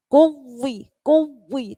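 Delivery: kuwi said slowly with a firm, unbroken press; pressed ending. Shown as a demonstration. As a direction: press kuwi gently, never cut it. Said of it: "ditekan tapi tidak terputus". (kuwi ditekan tanpa terputus)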